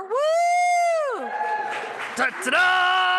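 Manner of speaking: high, loud victory whoop; triumphant whoop. Real, not acted. Pastor celebrating her marathon to the congregation, whooping. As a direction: cheer for her own finish.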